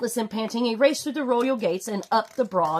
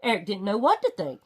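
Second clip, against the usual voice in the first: ordinary delivery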